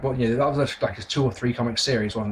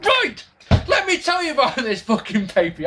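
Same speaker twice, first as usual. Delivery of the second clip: mock angry rant; 9 semitones higher than usual. Reviewing a bad pay-per-view for a friend, mock furious rant. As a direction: launch into a hammed-up furious review. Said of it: "So I go into".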